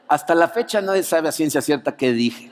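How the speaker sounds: ordinary talk, nothing marked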